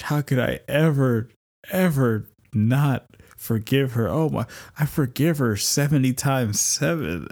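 tender, beaming declaration of love; overjoyed. Retold or imitated. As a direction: melt with joy over your wife. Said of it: "I'm just like".

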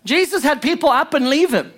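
high, emphatic pulpit delivery; impassioned preaching. Real, not acted. Preacher driving a point home to the congregation, impassioned. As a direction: preach it hard, pitch riding up.

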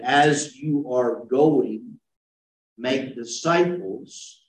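As you are going, make disciples, every word slowed; slow reading as written. Shown as a demonstration. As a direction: read the verse slowly and deliberately, word by word. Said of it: "if I was to read it like it is written".

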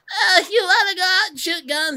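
put-on very high whiny child voice; annoying child voice. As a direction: whine it like an annoying kid. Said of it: "this really annoying child voice".

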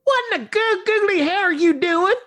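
very high, shrieky wife voice; shocked country wife. Acted. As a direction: squawk it like an appalled country wife. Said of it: "Jeb's wife walks in on him".

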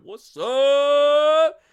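loud, high what's up; shouted greeting. Real, not acted. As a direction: call it out across the room. Greeting a chat member.

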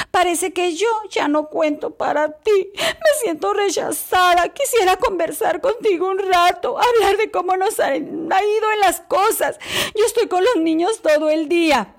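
lightly played wife feeling rejected; nervous wife.

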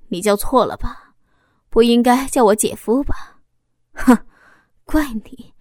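cool, mildly scornful questioning; indifferent, cold. A narrator's reading. Husband to his wife's lover, coldly. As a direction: scoff at him with measured disdain. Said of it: "老公冷漠地看着小峰说".

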